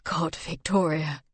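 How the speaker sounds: slow, soft, overwhelmed relief; relieved. A narrator's reading. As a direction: breathe out the relief.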